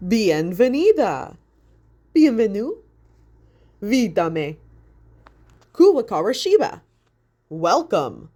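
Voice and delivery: big, loud announcer boom over the speakers; booming welcome. A narrator's reading. Ceiling speaker announcer booming at the visitor. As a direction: boom it out like a showman on a PA. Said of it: "boomed a voice from the ceiling speakers".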